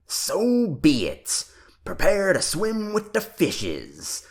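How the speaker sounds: mother playing pirate, bellowing; bellowed. A narrator's reading. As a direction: boom it like a mock pirate captain. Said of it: "Antonio bellowed".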